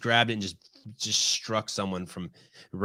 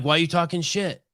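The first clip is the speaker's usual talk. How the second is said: cocky retort; 8 semitones higher than usual. Retold or imitated. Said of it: "I'm like".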